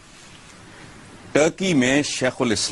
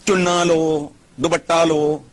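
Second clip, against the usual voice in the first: retold order, higher-pitched and drawn level; mock decree, pitched up from usual talk; Zia-ul-Haq; 5 semitones higher, 3 dB louder, slower than usual. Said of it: "Ziaul हक कहता था".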